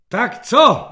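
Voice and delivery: loud, high, short question; impatient challenge. A narrator's reading. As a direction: fire it at a cowering subordinate. General challenges the cowering lieutenant. (hlasitá generálská výzva)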